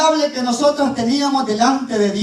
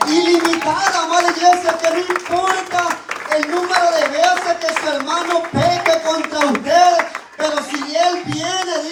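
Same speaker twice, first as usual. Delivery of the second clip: lifted, slowed preaching voice, pleading with the church; rising preaching; 3 semitones higher than usual. Acted. Pastor urging his beloved church to forgive, in a lifted preaching voice.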